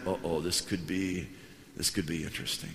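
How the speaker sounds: wary uh-oh. Retold or imitated. Pastor reacts to his student's warning look, wary.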